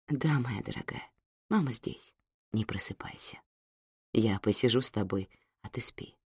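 hushed soothing whisper; soft whisper. Acted. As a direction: whisper so she stays asleep. Mother soothes sleeping daughter. (нежный шёпот)

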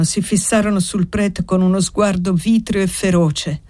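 plain reading voice

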